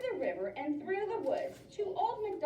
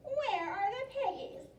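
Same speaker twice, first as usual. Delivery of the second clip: high witch voice; 8 semitones higher than usual. Acted. Witch demanding of cow.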